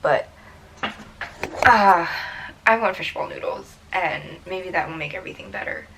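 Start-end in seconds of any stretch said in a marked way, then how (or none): none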